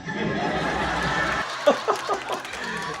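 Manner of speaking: high breathy laugh; real laugh. Real, not acted. Fan laughing at the couch joke, high. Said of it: "laughing at the clip".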